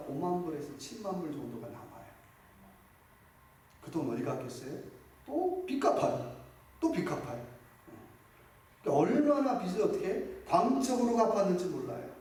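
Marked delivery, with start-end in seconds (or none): 5.3-7.5 exasperated high cry; emphatic exclamation. Acted. Mocking repeated debt payment.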